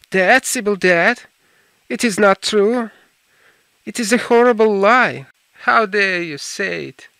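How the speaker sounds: loud, high, disbelieving cry of grief; anguished outburst. Acted. Dorian, told Sybil is dead, cries out in loud anguished disbelief. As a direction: hurl the denial back at him in shock.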